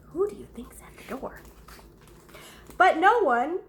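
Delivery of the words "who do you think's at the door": fast whispered question. Acted. Teacher whispering to children at storytime. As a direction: lean in and whisper it to the kids.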